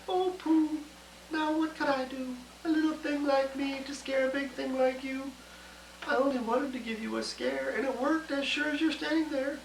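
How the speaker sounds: small teasing mouse, high; little mouse. Acted. Mouse talks to elephant.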